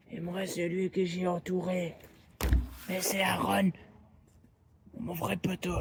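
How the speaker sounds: high, eerie stranger voice; creepy lost guy voice. Acted. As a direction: play the unsettling lost man. A figure's voice, far, higher.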